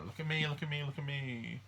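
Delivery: high, sing-song look at me me me; show-off attention seeker. Retold or imitated. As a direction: preen like a child begging attention. Mars in Leo showing off.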